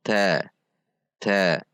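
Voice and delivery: ta with a gentle puff, still a T; light aspiration. Shown as a demonstration. Teacher models correct ta, lightly aspirated. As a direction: push a little air on ta, never CH. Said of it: "Ada dorongan udara".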